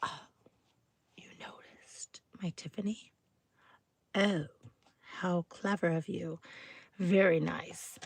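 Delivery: hushed, mock-flattered voice; soft, coy put-on delight; pleased Tiffany wearer. Someone replies to being noticed wearing Tiffany, hushed and flattered. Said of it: "And they'll go".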